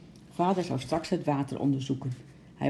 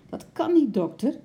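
high, loud objection; raised-voice protest; exclaiming protest; 11 semitones higher, 6 dB louder than usual. A narrator's reading. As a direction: cry out in disbelief. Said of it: "riep Bart".

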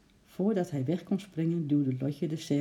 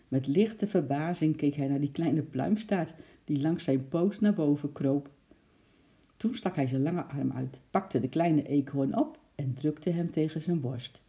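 usual speaking voice

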